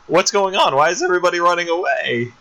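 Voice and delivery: bewildered, quickening into the second question; mock bewildered. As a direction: imitate a confused little bird left behind. Bird bewildered as everyone flees.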